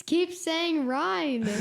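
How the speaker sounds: "Ryan" drawn out as one long syllable, RYNE. Retold or imitated. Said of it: "like an orange rind".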